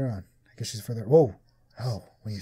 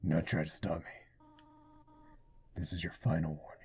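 demonic voice; 7 semitones lower, 7 dB quieter than usual. Acted. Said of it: "I'm giving her a demonic voice".

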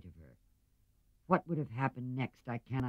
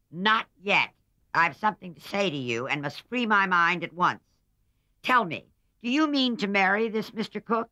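much higher, louder, stern warning; loud, high, imposing old aunt; impressive old lady; 10 semitones higher, 9 dB louder than usual. A narrator's reading. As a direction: lay down the law as a rich old aunt. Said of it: "said the old lady impressively".

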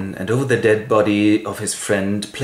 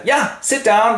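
raised-pitch command, lightly acted; mock impatient order; 12 semitones higher, 4 dB louder than usual. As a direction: hurry someone into a seat. Acted order before the play.

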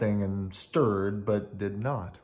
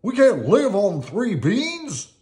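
high, slow, louder cartoon complaint; complaining farmer; 12 semitones higher, 9 dB louder, slower than usual. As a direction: protest the beans in a higher voice.

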